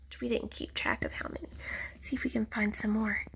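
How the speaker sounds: fast hushed whisper. Real, not acted. Reading to a child, fast whisper before searching again. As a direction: whisper it quickly, like a secret aside.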